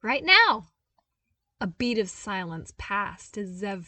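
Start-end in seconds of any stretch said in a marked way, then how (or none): 0.0-0.7 high, loud and delighted; cheerful young man, thrilled; gleeful exclaim. A narrator's reading. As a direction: blurt it out like the best surprise. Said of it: "He smiled even brighter".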